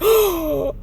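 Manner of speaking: big mock-horrified gasp; shocked gasp. Customer seeing whipped cream run out, horrified gasp. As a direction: gasp as if the world just froze. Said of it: "my whole world froze".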